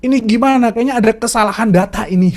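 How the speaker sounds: high, pushy indignant protest; mock angry complaint. Pastor protesting to the angel in mock outrage. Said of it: "dia marah betul".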